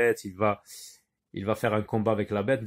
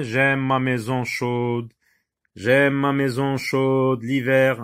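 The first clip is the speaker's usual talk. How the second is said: slow, measured verse reading; poem recitation; 4 dB louder, slower than usual. Acted. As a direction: read the poem aloud slowly.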